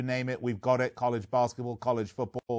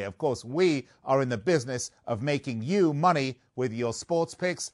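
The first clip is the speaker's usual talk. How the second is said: ordinary delivery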